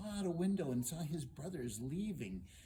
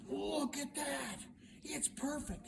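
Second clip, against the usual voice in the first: high, drawn-out cry of delight; delighted discovery; 13 semitones higher, slower than usual. Acted. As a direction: exclaim high over a treasure. Hero delighted by his find.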